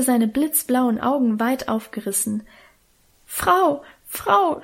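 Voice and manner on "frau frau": high, breathless stammer; stammering in fright. A narrator's reading. Frightened girl bursting into the kitchen, stammering high. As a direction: cry out the word twice, scared. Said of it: "stammelte sie".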